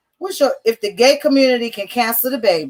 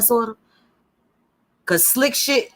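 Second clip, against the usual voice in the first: usual speaking voice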